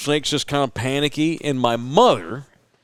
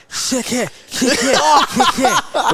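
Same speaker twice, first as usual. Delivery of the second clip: excited whoop; 10 semitones higher, slower than usual. Retold or imitated. Mother cheering as she grabs the snake, high whoop. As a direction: hoot it up like a wild woman grabbing a snake.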